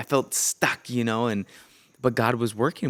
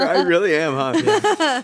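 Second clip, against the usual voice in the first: laughing; 8 semitones higher, 8 dB louder, slower than usual. Real, not acted. Laughing admitting he's a crier.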